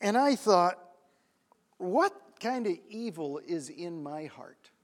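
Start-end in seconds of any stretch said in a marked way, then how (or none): 1.8-4.7 slow, low, soft realisation; sobered, remorseful question; hushed self-reproach. Retold or imitated. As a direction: confess it quietly, weighing each word. Remorseful realisation at story's climax.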